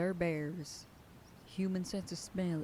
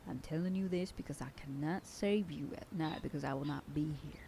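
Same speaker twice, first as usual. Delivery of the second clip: ordinary delivery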